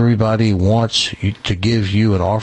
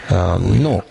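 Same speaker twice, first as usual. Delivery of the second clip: playful mock Spanish accent; put-on Spanish accent; 2 semitones lower than usual. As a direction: stay in a hammed-up Spanish accent. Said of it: "I'm talking the Spanish accent".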